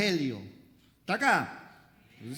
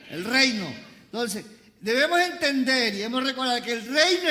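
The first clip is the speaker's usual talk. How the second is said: loud, high call to the congregation; full-force preaching; 5 semitones higher, 6 dB louder than usual. Real, not acted. Preacher rousing the congregation to repeat, full-force preaching. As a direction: drive the point home at full volume.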